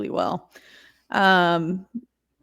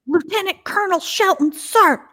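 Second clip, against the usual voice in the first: much higher, loud recruit yell; recruit shout; 12 semitones higher, 4 dB louder than usual. Retold or imitated. Recruit yelling his officer's full rank, high and loud. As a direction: bark it like a rattled recruit. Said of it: "He's like".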